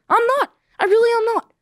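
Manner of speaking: high, half-crying protest that he's fine; mock whiny denial. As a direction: whimper "I'm not" like someone clearly panicking. Insisting he isn't panicking.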